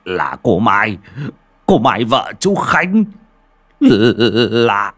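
stretched, halting, terrified revelation; full of fear. A narrator's reading. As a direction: stammer it out slowly, rising in terror. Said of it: "tia nhìn đầy sợ hãi".

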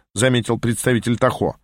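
plain reading voice

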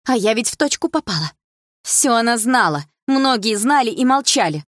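loud, high, indignant accusation; angry. A narrator's reading. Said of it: "а Дилара рассерженно выдохнула".

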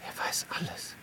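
hushed, frightened realisation; awed whisper. Acted. Antonius whispers in awe of Hilarion. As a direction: breathe it out in fear.

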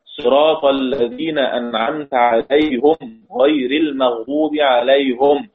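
slow recital, عليهم as "alayhum"; Khalaf's recitation. Shown as a demonstration. Teacher demonstrating Khalaf's reading, slow, عليهم as "alayhum". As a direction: recite slowly, "-hum" ending each عليهم. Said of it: "خلف".

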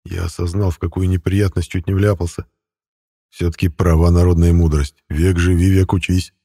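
ordinary delivery

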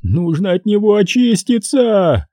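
high, slow, terrified cry; panicked cry. Acted. Хеннесси в панике кричит напарнику, истошный вопль. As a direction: plead in panic to be clean. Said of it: "кричал Хеннесси в панике".

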